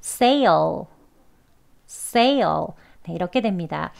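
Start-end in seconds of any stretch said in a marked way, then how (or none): 0.0-2.7 sale with falling end; sale demo. Shown as a demonstration. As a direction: model sale for repeating. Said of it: "같이 두 번 해볼게요". (따라 하기용 sale)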